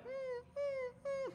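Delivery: high, thin whimper imitating a hurt dog. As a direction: whimper like a little dog in pain. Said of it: "she's whimpering".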